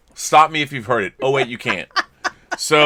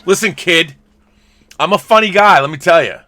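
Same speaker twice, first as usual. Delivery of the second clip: mock tough-guy boast; 5 semitones higher, 9 dB louder than usual. Playful boast to the kid.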